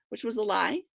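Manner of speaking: plain reading voice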